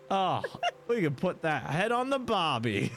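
high-pitched mock Aussie; hammed-up Australian joke voice; Australian accent. Game master joining the Aussie riff, high mock Australian accent. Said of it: "do an Australian accent instead".

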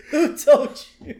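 high mock Burns with a laugh; laughing re-quote of who told you; lightly imitating Burns; repeating Burns line. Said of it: "I love that".

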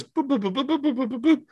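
high, soft little beeps like keypad tones; mock phone dial beeps. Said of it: "he goes".

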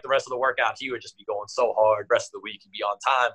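ordinary delivery

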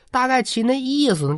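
usual speaking voice

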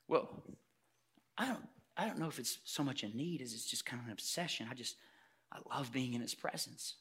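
soft, low, hesitant reply; little boy. Acted. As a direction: answer gently, feeling for the words. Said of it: "the conversation between the adult and the little boy".

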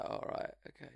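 scared whisper. Retold or imitated. Whispering back, scared of the skinhead in the front row. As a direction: barely breathe the words out in fear.

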